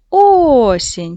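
Осінь with the weight on the first О; stress on first syllable. Shown as a demonstration. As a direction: lean on the O of Осінь, not the end. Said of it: "Поставимо наголос". (наголос на перший склад: О-сінь)

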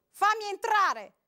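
man screaming at the door; shouting. A narrator's reading. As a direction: roar the order. Man demands entry.

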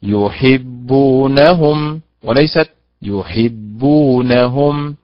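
slow يحبونهم, stress shifted in the second; teacher summing up the stress rule; right vs wrong stress. Shown as a demonstration. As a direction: contrast the two stresses slowly. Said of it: "إذن هي".

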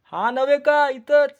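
lightly voiced son-in-law. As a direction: answer innocently from above. Hidden son-in-law answers high.

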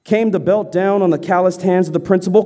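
normal talking voice

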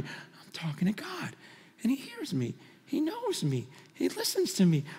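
hushed, almost breathless whisper; awed whisper. Real, not acted. Preacher telling the crowd God hears him, in an awed whisper. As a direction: drop to a whisper in wonder.

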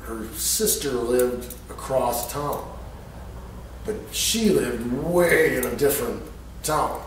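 ordinary talk

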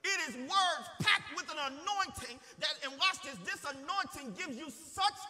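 high, loud, punched-out sermon delivery; preaching at full force. Real, not acted. Preacher telling the church of amazing grace, impassioned preaching. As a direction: drive each key word home with force.

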